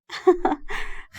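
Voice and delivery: short high, soft giggle; light laugh. A narrator's reading. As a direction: giggle at a friend's complaint. Friend laughs at the teasing complaint. (ضحكة خفيفة)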